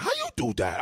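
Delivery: put-on loud Haitian-accented scolding; Haitian father. Imitating what the father said, Haitian-accented outrage. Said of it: "I know the father said".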